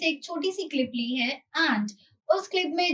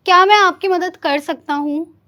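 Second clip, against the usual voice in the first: louder, emphatic Hindi क्या मैं आपकी मदद कर सकता हूँ, rising on मैं; loud drill; 3 semitones higher, 11 dB louder than usual. Shown as a demonstration. Teacher drilling learners in the Hindi meaning, loud. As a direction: call it out clearly for learners. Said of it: "teacher drilling phrase loudly".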